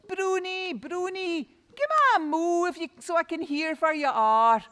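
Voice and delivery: loud, high call into the hills; boy calling for his lost cow. Acted. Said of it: "So he cries out".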